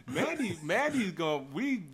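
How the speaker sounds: words spilling out in a high laugh. Real, not acted. Joking that Mandy comes on the show, laughing high. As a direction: still giggling as he says her name.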